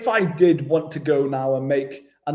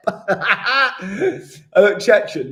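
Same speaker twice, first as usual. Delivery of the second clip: laughing; 6 semitones higher, 5 dB louder, much slower than usual. Real, not acted. Laughing at own joke.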